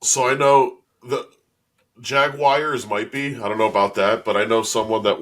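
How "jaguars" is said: "Jaguars" drawn out as JAG-wires; Southern-style pronunciation. Retold or imitated. Conceding a possible Southern variant, "Jaguars" as slow JAG-wires. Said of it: "that's a Southern thing".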